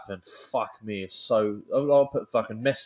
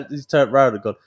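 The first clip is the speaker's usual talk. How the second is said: ordinary delivery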